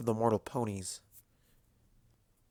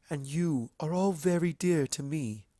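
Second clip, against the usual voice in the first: tender; 4 semitones higher than usual. A narrator's reading. Luna to the Ponyville friends, tender and sincere. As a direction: gently tell them they matter.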